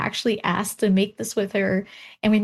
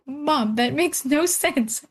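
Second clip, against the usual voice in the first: slow, higher disbelieving protest; exasperated daughter; 6 semitones higher, much slower than usual. Retold or imitated. Daughter protesting to her mother, slow and incredulous. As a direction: stretch it out, baffled at mom. Arguing with mom in story.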